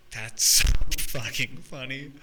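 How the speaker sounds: laughing through the words, slow and loud; can't stop laughing as you say it. Real, not acted. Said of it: "So I just start laughing".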